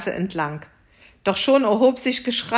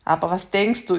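ordinary delivery

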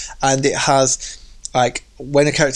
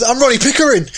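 high, loud self-important yell; mock hard man bellow; Ronnie Pickering shout; 11 semitones higher, 5 dB louder than usual. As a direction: announce your name like a threat. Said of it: "He goes".

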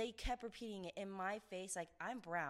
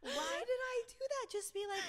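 very high, half-crying with laughter; laughing lament; 14 semitones higher, 3 dB louder than usual. Real, not acted. Speaker regretting how they treated the customer, laughing lament. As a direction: wail it through laughter at yourself. Cringing at own behaviour.

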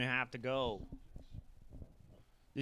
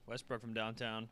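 ordinary delivery

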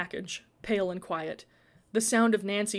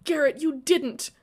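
usual speaking voice

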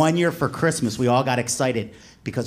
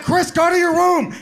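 high, loud parental yell; hammed-up dad at full volume; angry dad order; 5 dB louder than usual. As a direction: order a son out, hollering. Dad breaking up the fight.

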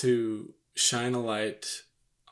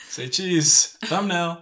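excited; 6 semitones higher, 9 dB louder, much slower than usual. Real, not acted. Posing the picture for camera.

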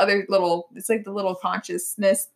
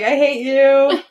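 louder playful I hate you; loud mock hatred; 6 semitones higher, 7 dB louder, slower than usual. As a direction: belt it as a joking insult. Quoting herself to trainer.

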